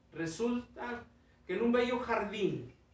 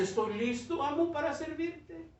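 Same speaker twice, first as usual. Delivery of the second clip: much higher, ready and willing; eager bamboo; 10 semitones higher than usual. Acted. Bamboo declaring itself ready, high eager voice. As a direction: sound eager to serve.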